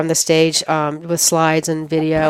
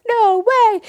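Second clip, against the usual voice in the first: squealed high in disbelief; a high, thrilled gasp of disbelief. Retold or imitated. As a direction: starstruck disbelief at hearing who is coming. Retold thrill at meeting idol.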